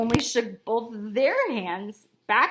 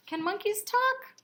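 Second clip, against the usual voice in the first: plain reading voice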